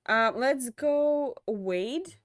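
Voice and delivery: ordinary delivery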